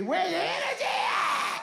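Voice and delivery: high, shouted demand; hollered challenge. Acted. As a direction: holler it to rouse a crowd. Berating listless young crowd.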